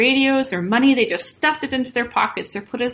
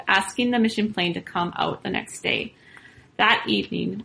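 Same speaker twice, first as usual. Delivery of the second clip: ordinary delivery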